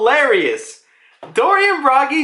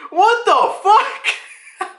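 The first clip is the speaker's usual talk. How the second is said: high, slow, stretched-out shocked cry; shocked yelp; 12 semitones higher, much slower than usual. Retold or imitated. Bragi gaping at Luffy, high stretched shocked cry. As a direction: act out the giants' stunned disbelief. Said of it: "Bragi's like".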